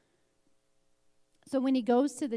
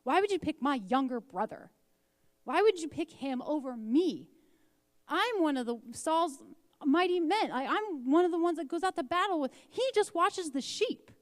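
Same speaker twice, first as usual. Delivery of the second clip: higher, louder, aching with hurt pride; pained older brother; 6 semitones higher, 4 dB louder than usual. Acted. Eliab complaining to God that his younger brother was chosen, pained and raised. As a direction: pour out the sting of being passed over. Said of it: "can you hear the pain in Eliab?".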